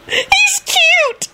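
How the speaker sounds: put-on extremely high girlish squeal; gushing announcement. A narrator's reading. Girl announcing crush over injured Lupin, squealed. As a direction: squeal it out, smitten. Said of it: "another one announced".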